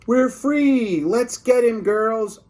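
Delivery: high excited cackle of escape; triumphant cry. Acted. Lunch ladies breaking free to attack the hero, high excited cry. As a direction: whoop like freed villains.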